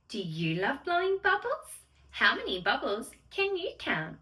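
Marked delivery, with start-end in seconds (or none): none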